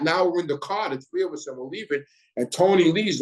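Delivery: ordinary talk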